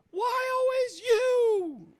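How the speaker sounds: slow, very high loud wail; exasperated scream. Acted. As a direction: drag out a shrill accusation. Abby blames the player.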